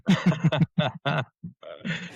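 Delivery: high rueful laugh. Real, not acted. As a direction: burst out laughing. Guest laughs at covid irony.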